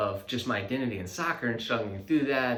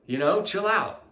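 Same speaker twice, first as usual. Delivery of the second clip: defensive snap; 9 semitones higher, 8 dB louder, slower than usual. Retold or imitated. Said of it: "my wife's like, "Calm down".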